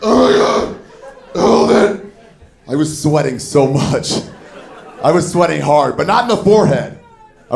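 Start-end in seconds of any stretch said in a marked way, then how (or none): 0.0-2.1 higher strained pleading to hold on; desperate self-urging. Retold or imitated. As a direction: grit through the panic. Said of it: "Just like".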